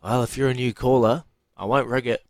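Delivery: usual speaking voice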